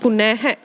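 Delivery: पुनः ending in a clear breathy h, like पुनह; visarga as h. Shown as a demonstration. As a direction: say पुनः with the h sound at the end. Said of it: "उसका उच्चारण लगभग ह की तरह होता है". (पुनः को अंत में ह की आवाज़ के साथ बोलें)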